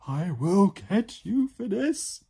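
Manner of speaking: high, loud, drawn-out fury; hammed-up screeching threat; screamed threat. A narrator's reading. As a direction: scream vengeance slowly. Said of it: "she screamed".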